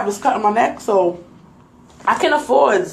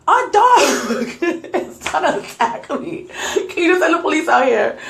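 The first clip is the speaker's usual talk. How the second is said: high-pitched plea broken by laughter; laughing exasperated plea; 7 semitones higher than usual. Retold or imitated. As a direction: retell a desperate plea while cracking up. Exasperated retold plea to emergency operator.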